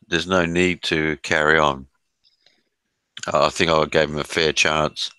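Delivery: ordinary talk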